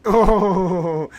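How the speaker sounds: high, loud "oh" breaking into laughter; delighted laughing outburst; bursting laugh. Real, not acted. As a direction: crack up at the replay. Laughing at the angry Karen replay.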